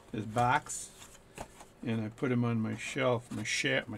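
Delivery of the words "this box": "box" with an American open vowel. Shown as a demonstration. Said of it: "American for box".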